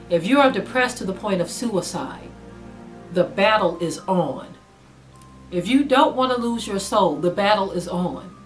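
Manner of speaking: ordinary delivery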